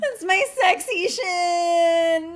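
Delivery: high, slow, laughing leprechaun voice; acted leprechaun line. Mock leprechaun taunting Nathan with a laugh. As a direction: squeal it out like a cackling imp. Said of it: "And he's like".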